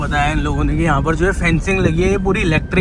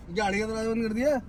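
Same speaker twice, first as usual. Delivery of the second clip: ordinary talk, nothing marked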